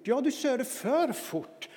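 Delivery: upset son. Retold or imitated. Son answers dad.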